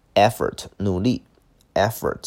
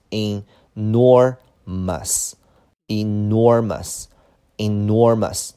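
"enormous" stretched out slowly with a long NOR; syllable by syllable; 5 dB louder, much slower than usual. Shown as a demonstration. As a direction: model "enormous" slowly for learners. Teacher models word syllable by syllable. (放慢拉长音节读enormous)

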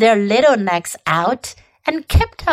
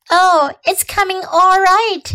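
light; 8 semitones higher than usual. A narrator's reading. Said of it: "she said lightly".

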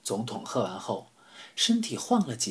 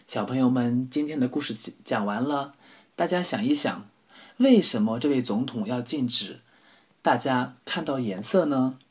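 put-on bright storyteller talking to kids; kindergarten teacher voice; 2 semitones higher, 4 dB louder than usual. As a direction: ask the little ones gently. Narrator addresses children.

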